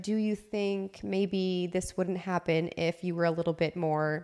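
soft, low, careful suggestion; gentle, not mean. Retold or imitated. Partner carefully raising discipline with a parent, gentle. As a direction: tread lightly, retelling a tactful question. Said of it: "I didn't say them in a mean way".

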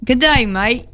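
attempted Aussie greeting, a bit British; Australian accent. Shown as a demonstration. Guest attempting the requested phrase, Australian accent. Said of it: "in an Australian accent".

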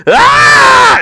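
high, drawn-out yell breaking into a scream; crazy comic impression. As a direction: talk like a lunatic, then shriek. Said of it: "He'd talk like this".

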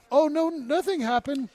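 high, loud, feigned nonchalance; mock innocence. Staff return Mom to her children, mock innocence. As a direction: brush it off far too brightly. Acts staff covering up mistake.

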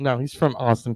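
ordinary delivery

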